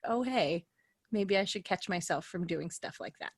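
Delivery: ordinary delivery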